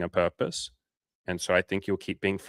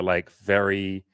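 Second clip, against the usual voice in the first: usual speaking voice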